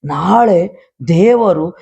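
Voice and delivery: rousing sermon delivery, rising and driving; fervent preaching. Real, not acted. Pastor preaching to the congregation about God's gifts, fervent. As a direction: preach it to the believers with conviction.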